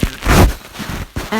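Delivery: ordinary delivery, nothing marked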